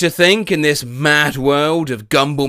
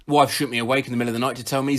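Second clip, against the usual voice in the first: normal talking voice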